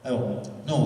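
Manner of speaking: usual speaking voice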